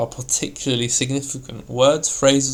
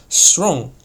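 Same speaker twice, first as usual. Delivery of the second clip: STRONG said higher, firm and forceful; a strong, raised demonstration of STRONG; 4 semitones higher, 5 dB louder than usual. Shown as a demonstration. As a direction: put strength into the word STRONG. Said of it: "in a strong tone".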